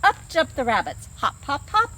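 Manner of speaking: ordinary delivery, nothing marked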